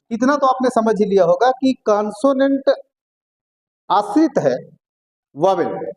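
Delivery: usual speaking voice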